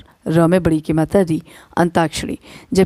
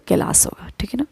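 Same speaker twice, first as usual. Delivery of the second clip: whispered; 7 dB quieter, faster than usual. Shown as a demonstration. Teacher whispering the right form to students. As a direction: whisper Kailash होगा. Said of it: "Kailash नहीं होगा".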